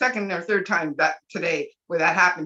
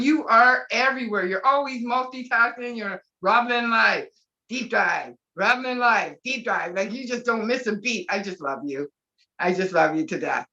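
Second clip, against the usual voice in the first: normal talking voice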